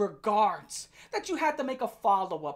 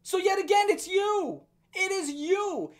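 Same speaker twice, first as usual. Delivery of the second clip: pointed accusation; 7 semitones higher, 3 dB louder than usual. Real, not acted. Accusing him directly.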